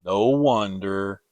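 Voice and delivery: slow, glum Eeyore; lightly voiced gloomy donkey. A narrator's reading. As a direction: mope the words out.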